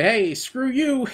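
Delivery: slow, high taunting quote; mocking brush-off. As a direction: tease it out slowly like a jerk. Mocking guy dismissing him.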